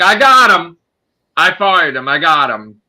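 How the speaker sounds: loud, smug bragging; smug mocking boast. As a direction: brag like a cocky investigator.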